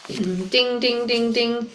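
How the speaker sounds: soft imitated crosswalk ding; quiet mock crossing beep; crosswalk chime. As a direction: chime it like a street signal. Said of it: "Another crosswalk sound".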